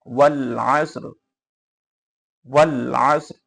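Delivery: slow ওয়াল আসর ending on vowelless r; audible final r. Shown as a demonstration. Teacher drilling the verse stop, ওয়াল আসর with bare r. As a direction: pronounce the r of আসর without adding a vowel. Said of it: "রটা উচ্চারণ হবে".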